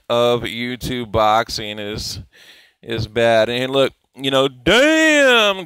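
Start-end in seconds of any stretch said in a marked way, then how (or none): 4.5-5.7 surprised damn. Real, not acted. Sees a harsh headline.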